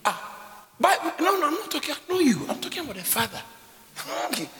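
ordinary talk, nothing marked

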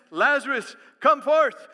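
loud, high command to the dead; a ringing preacher's command; commanding call. Retold or imitated. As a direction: call him out of the tomb with full force. Jesus commands dead man rise.

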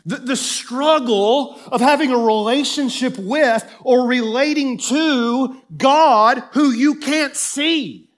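preacher pressing the point home to the church, loud; full-force preaching. Acted. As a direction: drive it home to the congregation.

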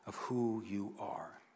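breathy whispered close of a prayer. Acted. Preacher voicing a prayer to God, ending in a slow whisper. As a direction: let the last words of the prayer fall to a slow whisper.

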